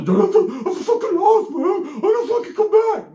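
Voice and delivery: high, loud, slow blubbering wail; laughing mock sob. Beaten kid crying as they leave, high slow laughing wail.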